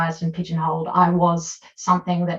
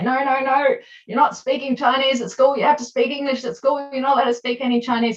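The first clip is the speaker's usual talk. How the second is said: higher, insistent parent voice; strict parent; 7 semitones higher than usual. Retold or imitated. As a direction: mimic a strict parent laying down the rule. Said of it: "when parents say".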